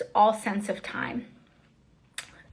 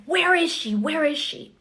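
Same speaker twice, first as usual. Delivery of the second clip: retold yelling; 6 semitones higher than usual. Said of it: "these screams and these yells".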